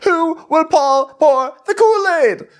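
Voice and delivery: very high, slow wail; hammed-up melodramatic plea; theatrical king. King theatrically demands a drink.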